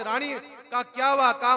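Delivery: ordinary delivery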